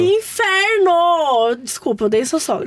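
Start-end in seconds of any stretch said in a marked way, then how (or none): 0.0-1.5 high, very drawn-out exclamation; exasperated outburst. Real, not acted. Host fed up with listener's mother-in-law, exasperated. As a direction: stretch it out in comic exasperation.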